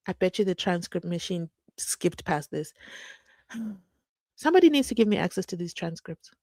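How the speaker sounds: usual speaking voice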